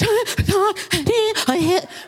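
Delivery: high, gulping fake sobs; hammed-up ugly-cry noises; mock sobbing. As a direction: wail through a snotty, breathless mock cry. Imitates booger snot crying.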